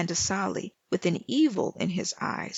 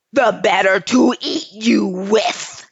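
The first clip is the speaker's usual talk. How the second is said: louder howl of the wolf; 8 dB louder than usual. Acted. The wolf howling at the girls before lunging, louder. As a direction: let the wolf's anger burst out. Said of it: "so he howled".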